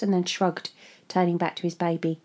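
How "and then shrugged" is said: usual speaking voice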